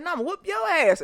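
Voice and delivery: put-on high, loud cheeky child voice; mock kid defiance. The little boy sassing his granny, high and loud. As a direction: talk back like a bratty kid. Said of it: "And he said".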